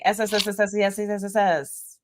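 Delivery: hissing s sounds to call a pet. Real, not acted. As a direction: hiss a row of s sounds. Calling the cat with hissing.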